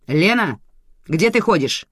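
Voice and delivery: annoyed boss demanding an answer; scolding boss. A narrator's reading. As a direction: call out to a straying subordinate. Boss scolds missing employee.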